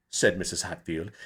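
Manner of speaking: usual speaking voice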